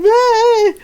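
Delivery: a flustered, giddy squeal as she runs off. Retold or imitated. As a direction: let out a giddy shriek.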